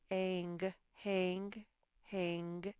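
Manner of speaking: "hang" sounded out softly, with a short a; sounding out hang. Shown as a demonstration. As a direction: try "hang" slowly, like testing the word. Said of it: "Not that one".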